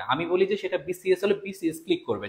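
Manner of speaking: ordinary talk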